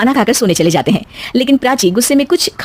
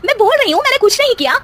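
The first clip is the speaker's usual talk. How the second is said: much higher, wailing denial; indignant protest; 14 semitones higher than usual. Acted. Daughter-in-law wrongly accused by mother-in-law, shrill wailing denial. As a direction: cry out that you did nothing.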